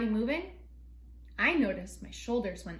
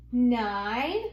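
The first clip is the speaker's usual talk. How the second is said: usual speaking voice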